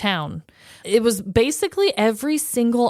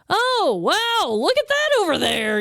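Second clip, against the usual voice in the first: put-on high-pitched, easily impressed old person; mock amazement; 10 semitones higher than usual. Imitating open-minded old people over chill things, mock amazement. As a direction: act wowed, starting high and easing down. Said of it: "old people are just so open-minded".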